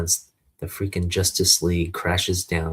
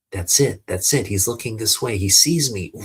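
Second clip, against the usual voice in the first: louder, urgent realisation; excited hope; 3 semitones higher, 4 dB louder than usual. Acted. Boy sees the hero look his way, excited hope. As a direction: build in excitement as hope arrives.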